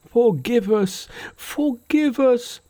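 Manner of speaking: very high, drawn-out pleading; terrified cry. A narrator's reading. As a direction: beg for mercy in a high wail.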